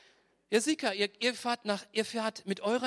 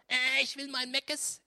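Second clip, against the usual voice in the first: whiny eaglet; 6 semitones higher, slower than usual. Acted. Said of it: "motzt und schreit".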